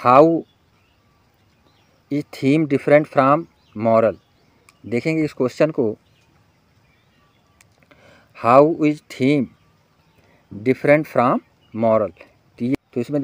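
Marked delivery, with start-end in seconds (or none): 0.1-4.1 very slow, word by word; deliberate dictating read; slow dictation. Shown as a demonstration. Dictating exam question to students. 8.5-12.1 very slow, word by word; deliberate dictating read; slow dictation. Shown as a demonstration. Repeats question for dictation.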